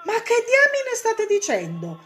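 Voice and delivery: very high, loud florist's outburst; indignant shopkeeper crying out; exasperated exclamation. A narrator's reading. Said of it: "esclamò il fioraio".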